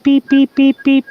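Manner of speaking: high mock alarm beeping; smoke alarm beeps. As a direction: beep like a smoke detector.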